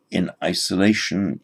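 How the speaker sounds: ordinary delivery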